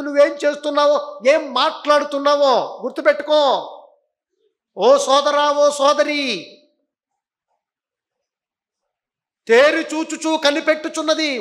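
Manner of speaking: loud, high, forceful sermon; fiery preaching. Acted. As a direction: thunder it at the congregation.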